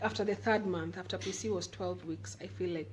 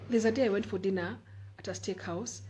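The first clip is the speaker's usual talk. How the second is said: normal talking voice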